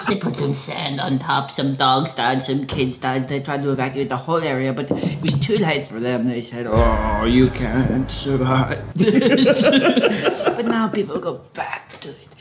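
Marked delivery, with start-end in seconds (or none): 0.1-9.7 put-on low, heavy Russian accent; hammed-up Russian accent, dropped low. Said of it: "Your Russian accent has slipped a little bit".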